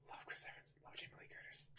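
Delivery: hushed, whispered fan confession; whispered aside. Real, not acted. Whispered fan aside about cast.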